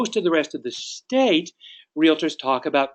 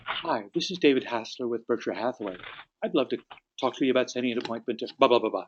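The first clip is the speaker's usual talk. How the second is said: soft, subdued phone introduction; quiet, matched tone; 2 semitones lower, 6 dB quieter, faster than usual. Acted. Agent answering a quiet person in their tone, softly. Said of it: "If it's a quiet person, then say".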